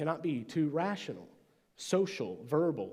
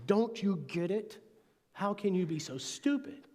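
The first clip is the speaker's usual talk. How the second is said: frustrated; 7 semitones higher than usual. Retold or imitated. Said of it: "very frustrated with my friends".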